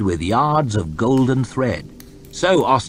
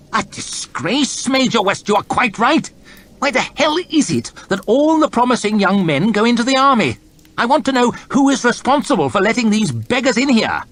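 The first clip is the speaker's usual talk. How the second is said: high, louder blustering rant; indignant rant; 5 dB louder than usual. Acted. Lord chamberlain ranting about beggars to his officials. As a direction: bluster at everyone. Hoff rants at the room.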